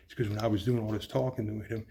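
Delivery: ordinary talk, nothing marked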